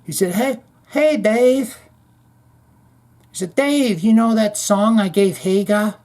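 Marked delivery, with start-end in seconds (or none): 0.4-1.8 high put-on imitation of a guitarist's accent; mock accent, pitched way up; [name]. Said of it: "and then he adopted Eddie's accent". 3.6-6.0 high put-on accent; high mock-accented question; [name]. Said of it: "and then he adopted Eddie's accent".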